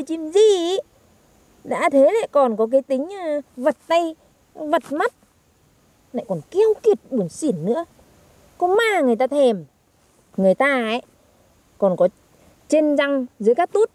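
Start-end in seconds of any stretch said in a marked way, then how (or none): none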